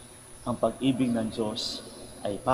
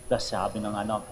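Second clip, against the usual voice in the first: ordinary talk, nothing marked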